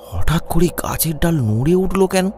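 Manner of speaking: ordinary delivery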